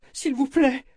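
put-on high-pitched pleading little girl; imploring child. A narrator's reading. Frightened girl begging the strange woman, imploring. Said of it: "implora-t-elle".

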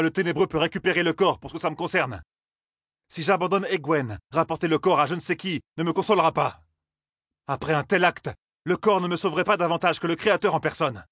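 refusing to abandon her. A narrator's reading.

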